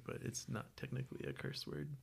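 whispered, a touch embarrassed; whispered sheepish apology to listeners. Real, not acted. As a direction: drop to a soft whisper and apologise.

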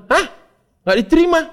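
high, loud stunned question; father can't believe his son failed; a sudden loud, high-pitched outburst of disbelief; shocked disbelief. Retold or imitated.

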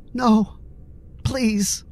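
a scared man close to crying; near tears. A narrator's reading. Billy begging the thug, near tears. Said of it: "on the verge of tears".

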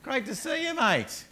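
high, loud, cheery g'day to a mate; cheery greeting. Retold or imitated. Paul welcoming Epaphras at his door, high cheery greeting. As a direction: greet an old friend with big delight. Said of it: "he goes".

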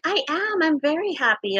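high, insistently happy retelling; put-on happiness. Girl insisting to a classmate she is happy, put-on cheer. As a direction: play up being very happy. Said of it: "talking out of my ass".

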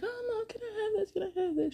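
put-on soft, high pleading kid voice; whiny child. Little boy begging mom for candy, whiny. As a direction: imitate a small boy begging, high and quiet. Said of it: "he was kind of whining".

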